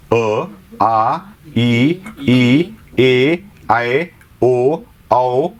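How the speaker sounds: each vowel sounded out one by one; vowel recitation. Shown as a demonstration. Teacher modelling vowels for students to copy. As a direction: model the vowels for pupils to repeat. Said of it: "याचा उच्चार तुम्ही तुमच्या तोंडाने करून बघा".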